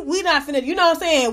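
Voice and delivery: ordinary talk, nothing marked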